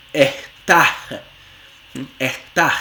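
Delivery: "estás" as "ehtá", s breathed as h; aspirated s. Shown as a demonstration. Teacher demonstrating speakers who eat their s's. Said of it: "hay gente que dice".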